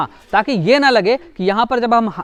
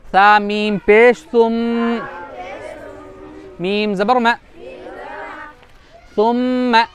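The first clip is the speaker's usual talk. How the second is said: spelling drill; slower than usual. Shown as a demonstration. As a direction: chant each letter slowly for the class. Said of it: "मीम मुशद्दद की मिसाल".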